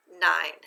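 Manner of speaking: nine said alone, level, without added accent; unaccented syllable. Shown as a demonstration. Teacher sounds out second syllable for students, nine unaccented. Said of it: "without the accent".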